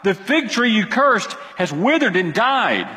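high, excited calls of look; excited exclaim. Acted. Disciples call to Jesus about the tree, excited and high. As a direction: urgently point it out to him. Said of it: "and exclaimed".

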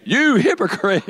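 high, ringing accusation; hammed-up accusation; playful mock rebuke. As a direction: hurl it high for the crowd to copy. Has congregation repeat rebuke to neighbour.

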